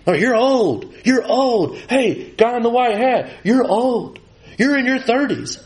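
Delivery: high-pitched mock jeer, no real shouting; retold heckling yell. Drunk students heckling the coach on the sideline, mock yelling. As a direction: imitate taunting hecklers without much volume. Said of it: "They start yelling at me".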